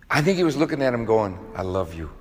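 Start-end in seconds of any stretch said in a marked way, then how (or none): 1.5-2.1 soft, quiet and gentle, Jesus speaking to Peter; hushed loving reassurance, much softer; tender love. Retold or imitated. Jesus's gaze voiced with love.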